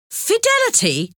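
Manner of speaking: very high-pitched outcry; crying out. A narrator's reading. Rosa throwing the prisoner's word back, crying out high. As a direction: echo the word in high, indignant disbelief. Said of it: "Rosa cried".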